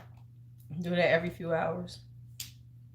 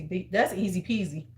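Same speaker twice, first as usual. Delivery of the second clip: ordinary talk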